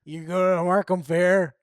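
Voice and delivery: loud mock Markham accent. Local asks about the fair, loud mock Markham accent. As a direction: call it out in a put-on Markham accent. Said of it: "That's a Markham accent".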